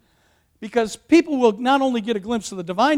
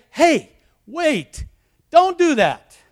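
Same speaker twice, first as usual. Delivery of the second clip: urgent protest; 8 semitones higher, 5 dB louder, much slower than usual. Acted. Acted quote stopping the worshippers.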